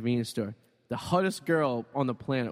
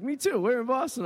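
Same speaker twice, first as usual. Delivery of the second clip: high, excited local guy; surprised Boston guy in the desert; guy from Lynn; 9 semitones higher than usual. Retold or imitated. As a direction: voice the stranger way up high. Guy from Lynn voiced in story.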